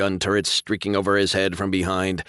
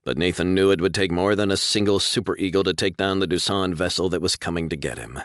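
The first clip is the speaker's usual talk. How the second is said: ordinary delivery, nothing marked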